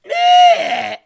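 very high, loud strangled screech; dying dinosaur roar. Real, not acted. Host lets out a screech he calls a dying dinosaur, loud screech. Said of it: "Sound like a dying dinosaur".

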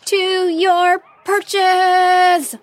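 high, very long-drawn battle call; bellowed command. A narrator's reading. Heroine calling orders to her dragonfly warriors, bellowing. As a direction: bellow it high, stretching every word. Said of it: "cupped her hands around her mouth and bellowed".

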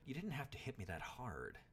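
put-on boy complaining after a hard knock; griping. A narrator's reading. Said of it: "griped the boy".

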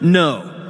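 ordinary talk, nothing marked

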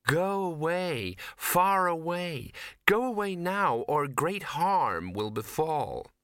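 high, slow, entranced sweetness; sweet. A narrator's reading. Entranced woman warning Victor away, slow sweet intoning. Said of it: "she said sweetly".

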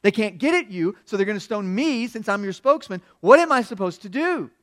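loud, high-pitched plea to God; acted-out exasperated appeal; desperate cry. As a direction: cry out at your wits' end. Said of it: "Moses turns around and cries to the Lord".

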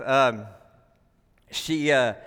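usual speaking voice

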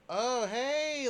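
high, bright startled hey; startled by a coworker, played off casually; startled greeting. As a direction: cover the jump with a casual hi. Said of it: "I'll play it off".

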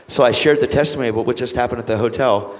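usual speaking voice

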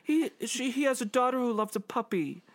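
high-pitched mock plea; pleading public. Imitating everybody excusing Nixon, high mock pleading. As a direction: beg in a squeaky put-on voice. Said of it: "everybody was like".

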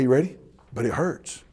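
ordinary talk, nothing marked

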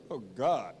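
ordinary talk

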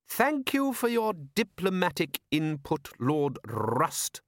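high, slow, measured ruler's voice; dry patrician. Acted. Patrician silencing Lord Rust, drily courteous. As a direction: cut him off with elaborate politeness.

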